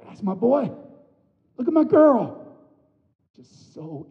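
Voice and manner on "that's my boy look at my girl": high, loud beaming father voice; proud father. Retold or imitated. God the Father delighting in his child, high and proud. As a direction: beam with pride at your child.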